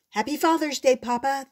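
bursting into the room to cheer for Father's Day; excited yell. A narrator's reading. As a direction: wake Papa up with a cheerful shout. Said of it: "they yelled".

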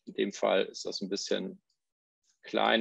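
ordinary delivery

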